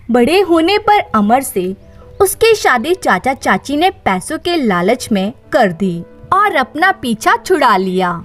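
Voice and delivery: usual speaking voice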